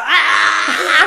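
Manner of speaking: high, loud electrocution scream; mock scream. Fitter stuck to the live fence, comic high scream. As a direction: scream like someone being shocked, hammed up. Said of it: "he's, like, screaming".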